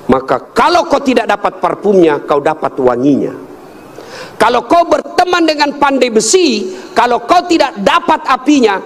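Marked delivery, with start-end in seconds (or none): 4.4-8.8 high, declaimed parable; emphatic preaching. Real, not acted. Preacher illustrates choosing friends with the blacksmith, high emphatic declamation. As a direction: hold the pitch high through each condition.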